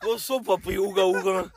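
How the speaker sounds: high goofy caveman voice. Pretend. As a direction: talk like a caveman. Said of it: "Es como".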